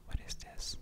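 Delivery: ordinary talk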